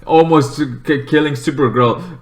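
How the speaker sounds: ordinary talk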